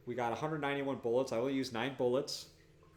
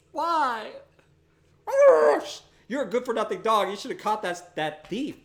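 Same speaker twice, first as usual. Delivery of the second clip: scolding the dog; 8 semitones higher, 9 dB louder than usual. Pretend.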